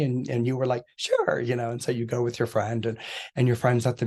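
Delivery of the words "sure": high-pitched, chirpy sure; mock-eager agreement. Agreeing to go along with a friend, mock-eager squeak. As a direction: squeak out a willing yes. Voicing an eager helper's reply.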